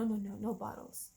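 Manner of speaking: ordinary talk, nothing marked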